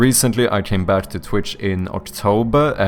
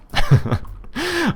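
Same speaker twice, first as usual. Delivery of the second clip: high burst of laughter. Real, not acted. Laughs after joke threat.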